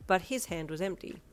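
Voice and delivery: usual speaking voice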